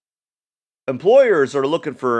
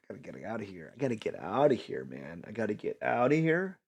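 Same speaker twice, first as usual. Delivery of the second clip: escape scheming; 10 dB quieter, faster than usual. Retold or imitated. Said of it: "You're like".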